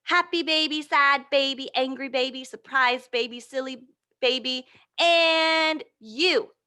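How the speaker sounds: ordinary delivery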